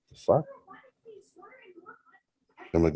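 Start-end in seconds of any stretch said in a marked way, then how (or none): 0.1-0.4 high, sharp disbelief; retold startled exclamation. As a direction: jolt up in pitch at the shock. Sees wrong face in dark.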